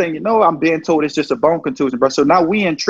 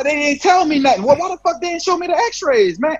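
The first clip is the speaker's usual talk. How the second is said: high, pitched-up outrage; outraged disbelief; 10 semitones higher than usual. Retold or imitated. Player learning his leg was cracked, high and outraged. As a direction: demand answers, stunned.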